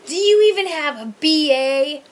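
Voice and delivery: loud, slow sneering rant with laughs breaking in; mocking drunk insult. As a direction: spit out the insult like a belligerent drunk. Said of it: "then he was like".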